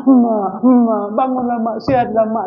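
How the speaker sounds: mock whimper, high; hammed-up crying to mother; whining children. Children whimpering at their sick mother's bedside, mockingly high. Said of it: "merengek kalian semua".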